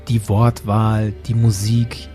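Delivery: usual speaking voice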